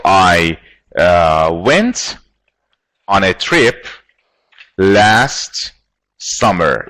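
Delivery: word by word with long pauses; halting, composing-aloud delivery; slow dictation. Shown as a demonstration. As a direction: piece the sentence together slowly, pausing between chunks. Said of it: "make a sentence with that".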